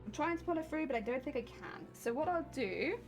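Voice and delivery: normal talking voice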